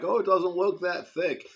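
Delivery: high, loud carefree remark; casual dismissive. Retold or imitated. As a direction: brush it off without a care. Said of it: "And I'm like".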